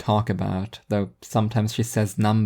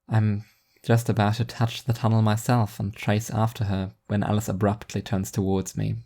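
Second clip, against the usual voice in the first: plain reading voice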